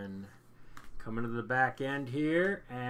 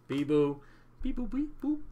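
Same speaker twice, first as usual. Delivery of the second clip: robot beeps; 7 semitones higher, 4 dB louder than usual. Pretend. Playful robot beeps while sorting.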